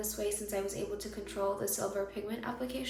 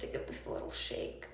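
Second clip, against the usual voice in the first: whispering; 5 dB quieter than usual. Real, not acted. Whispered aside in tutorial.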